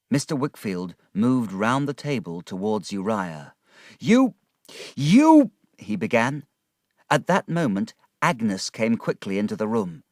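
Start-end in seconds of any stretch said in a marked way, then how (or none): 4.0-5.5 furious shout. A narrator's reading. Wickfield furious, stammering.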